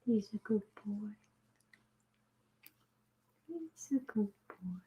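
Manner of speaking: soft whispered cooing; whispering to a pet. Real, not acted. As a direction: hush and coo gently. Whispering to the kitten.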